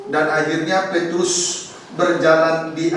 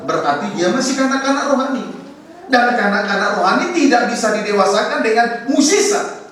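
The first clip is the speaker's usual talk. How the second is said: high, loud preaching climax; fervent preaching; 8 semitones higher, 3 dB louder than usual. Real, not acted. Pastor driving the point home to the congregation, high and loud. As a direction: press the point hard on the congregation.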